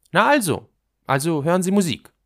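put-on loud, high girl's voice; impatient girl. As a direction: exclaim it high, as if finally getting through. Girl triumphant at his answer. (Mädchen, laut: na endlich)

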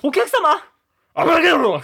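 loud, high hammed-up curse at a customer; mock spell shout. Clerk shouting a curse at rowdy customers, hammed-up yell. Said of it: "お客がわー騒いでる時に".

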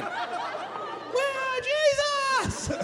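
high falsetto wail; mock worship cry. Worship service in a burning van, hammed-up high wail.

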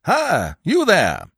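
high, loud hail; peremptory shout; calling up. A narrator's reading. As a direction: holler up to someone hidden overhead. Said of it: "he addressed the roof of the barn".

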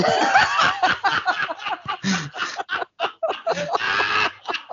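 long high helpless laugh. Real, not acted. Bursts out laughing at pitch.

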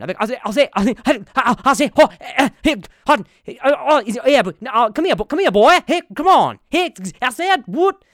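loud, high, stuttering cartoon rooster; Foghorn Leghorn. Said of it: "I would be, I would be f-, foghorn leghorn".

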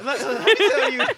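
can't stop laughing while starting the story; giggling interruption to take the floor. Real, not acted.

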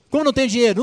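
a king who can't believe there's no money; king scolding a servant who cannot pay; indignant king. Acted. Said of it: "ele falou assim".

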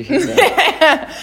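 high, slow, laughing tease; teasing. Real, not acted. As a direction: needle her playfully. Teasing co-host about cardio.

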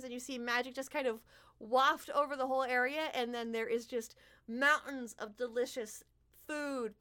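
ordinary delivery

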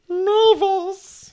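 name sung up high; high call. Real, not acted. Calling to the cat Mavis in a high voice.

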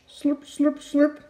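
high, wet slurp slurp slurp; slurping sound. A narrator's reading. Narrator slurping along with the baby's feeding. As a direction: slurp it up like a hungry tadpole.

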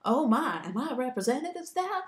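high, wavering little-miss voice; mock demure shock. Early [name] reacting to her public image, mock demure shock. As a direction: put on dainty, innocent dismay.

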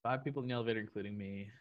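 soft, absent-minded read-back; half-aloud, puzzling it over; reading to oneself. Real, not acted. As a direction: read it quietly to yourself while thinking. Rereading the riddle to himself.

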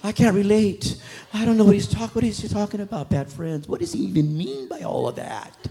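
mock-innocent denial; mock holy churchgoer. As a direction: play dumb like a pious churchgoer. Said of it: "Don't act so holy".

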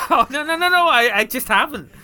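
high laughing denial; laughing protest. Real, not acted.

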